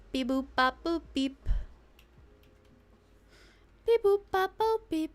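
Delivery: chirpy little robot beeps; toy robot voice. Pretend. Voicing a simple robot.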